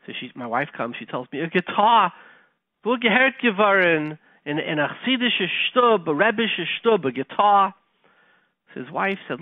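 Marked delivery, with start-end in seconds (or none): 1.4-7.7 higher, louder retold outrage; indignant disbelief. Rebbe balking at his son wanting a guitar, indignant. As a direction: play the scandalized father, voice raised. Father reacts aghast to guitar request.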